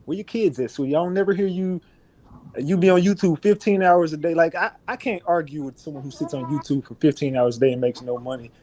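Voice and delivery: ordinary talk, nothing marked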